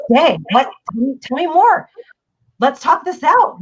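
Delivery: high, urgent, keyed-up voice; match their agitation, pitched well up; agitated matching. Acted. Parent matching a freaking-out kid, urgent and high.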